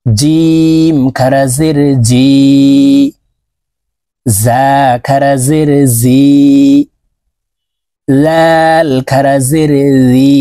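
each ee stretched long; slow drill recitation. Shown as a demonstration. Teacher drilling khara zer letters with viewers, slowly. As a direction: chant it, drawing each vowel out. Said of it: "এক আলিফ টেনে উচ্চারণ করতে হবে".

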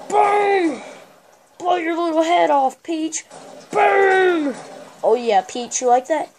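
loud, high hammed-up war cries; mock battle shouting. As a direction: yell playful threats at a game rival. Mock attack on Peach in game.

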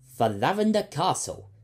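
rally the crew; announcing. A narrator's reading. Captain rallying his excited crew, loud and high. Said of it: "announced Captain Fryce".

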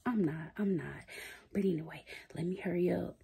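sneaky whisper upstairs. Real, not acted. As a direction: whisper so she can't hear. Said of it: "I know I'm looking crazy".

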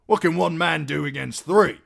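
high, loud protest; indignant husband. A narrator's reading. Husband excusing himself over horses, loud high protest.

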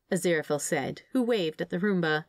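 usual speaking voice

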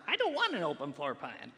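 mildly hammed-up contrarian objection; contrary. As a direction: play the stubborn holdout, lightly exaggerated. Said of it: "I'm always the contrary person".

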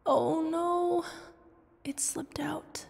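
high gasp then hushed whisper; panicked, hushing herself; flustered whisper. Acted. Realizing she blurted love to Darkness, whispered.

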